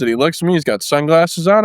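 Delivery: ordinary talk, nothing marked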